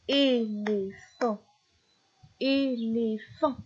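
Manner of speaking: "éléphant" slowly, in three even beats; syllable by syllable. Shown as a demonstration. Teacher showing a child the syllables, "éléphant" slowly split. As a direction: separate "éléphant" into é-lé-phant. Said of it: "combien de syllabes".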